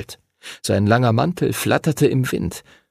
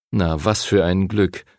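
usual speaking voice

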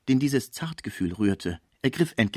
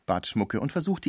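plain reading voice

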